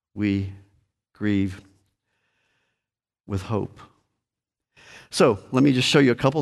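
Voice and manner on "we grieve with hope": softer, lower, spaced-out words; soft conclusion. Real, not acted. Preacher gently closing the point for congregation, hushed. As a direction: drop to a hushed, slow close.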